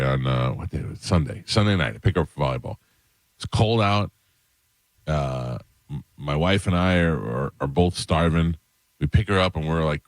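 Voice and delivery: normal talking voice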